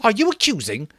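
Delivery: high, loud enraged challenge; angry shout. A narrator's reading. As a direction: bristle at the accusation. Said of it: "Jack shouted in rage".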